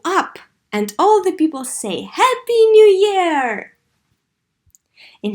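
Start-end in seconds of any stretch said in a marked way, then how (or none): 2.0-3.8 high, loud, drawn-out cheer; joyful New Year shout; excited cheer. Acted. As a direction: call it out like the crowd at midnight. Crowd cheers in read text.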